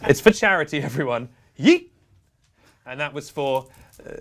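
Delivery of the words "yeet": short, high, louder yelp rising sharply; yeet shout. Pretend. Host throws something for charity, high excited shout. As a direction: yell it as you hurl something.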